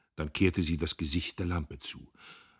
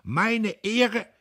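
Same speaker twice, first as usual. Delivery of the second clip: very high, loud outcry; outraged cry; 8 dB louder than usual. A narrator's reading. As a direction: gasp out his honour. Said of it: "Er schnappte".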